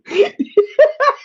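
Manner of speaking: high, loud burst of laughter. Real, not acted. Shop owner cracking up at his own retort, high laughter. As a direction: laugh hard at your own story.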